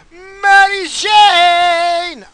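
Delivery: excited shout. Real, not acted. Announcing weed.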